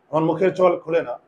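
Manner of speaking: usual speaking voice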